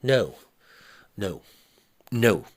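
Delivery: panicked wife pleading; hysterical. A narrator's reading. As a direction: scream it in panic, losing control. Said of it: "She'd almost become hysterical".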